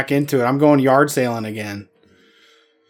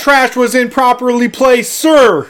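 loud, high mock military yes-sir; barked sir yes sir; 8 semitones higher, 4 dB louder than usual. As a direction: bark it like a cowed private. Mock obedient reply to police.

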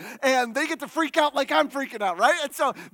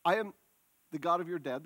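softer, intimate retelling of God's words; hushed God voice; 7 semitones lower, 6 dB quieter, faster than usual. Acted. Preacher quietly voicing God to Moses, hushed. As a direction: say it gently, as if confiding.

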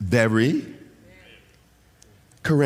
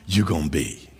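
usual speaking voice

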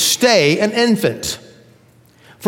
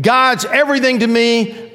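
high-pitched mock elation; emotional high; 6 semitones higher than usual. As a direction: play a new believer riding high. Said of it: "Up and down".

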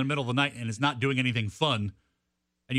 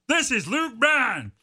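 higher, slow drawled name drop; 7 semitones higher, much slower than usual. Shown as a demonstration. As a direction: stretch it slowly like a country star. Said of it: "That's as good as I can do".

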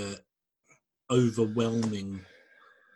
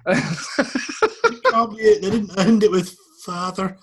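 high, loud words through real laughter; laughing; 9 semitones higher, 7 dB louder than usual. Real, not acted. Co-host cracking up at the failed accent, laughing. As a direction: crack up while protesting.

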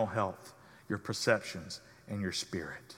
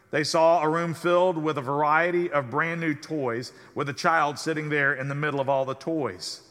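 normal talking voice

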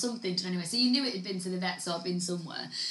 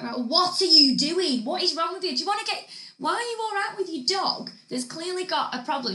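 raised, higher indignant questions at the dog owner; retold indignant telling-off; 6 semitones higher, 4 dB louder than usual. Retelling scolding the careless dog owner, indignant. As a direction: scold her like she should know better.

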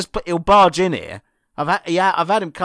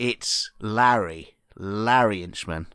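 deep, slow character voice; Larry Inchman voice; 8 semitones lower, much slower than usual. Acted. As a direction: drop low and drag it out.

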